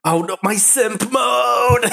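high, slow swooning whine; swooning monster. Pretend. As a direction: melt with mock longing. Monster melting for a woman.

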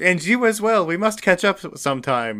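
high, loud fake French accent. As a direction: exchange pleasantries in a hammed-up French accent. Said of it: "In a really fake French accent".

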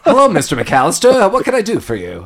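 high, loud bright "Hello"; chirpy hotel clerk. Retold or imitated. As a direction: sing the greeting like a clerk. Hotel clerk answers cheerfully.

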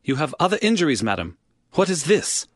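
startled, pressing the question; surprised exclamation. Acted. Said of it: "Holmes uttered an exclamation".

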